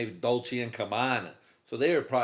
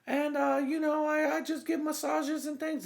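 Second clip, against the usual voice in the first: high falsetto woman's voice; squeaky put-on lady voice for the masseuse; high girly voice. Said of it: "And she's like".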